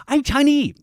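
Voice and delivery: loud, high-pitched comic impression; Dave Chappelle. Comedian borrowing another comic's bit, loud high mimicry. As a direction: shout it high in a caricatured comedian's voice. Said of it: "I'll do the Dave Chappelle".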